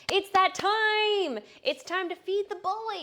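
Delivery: high, slow excited singsong; singsong dinner call. Real, not acted. Owner announcing dinner time to her excited puppy. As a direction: hype the dog up for dinner.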